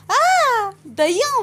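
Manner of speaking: high, loud panicked shriek. A narrator's reading. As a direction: scream as it touches you. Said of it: "అని మరింత గట్టిగా".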